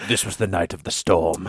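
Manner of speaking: epic storyteller. Pretend. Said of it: "He's weaving an epic tale".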